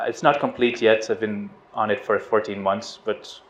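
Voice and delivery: usual speaking voice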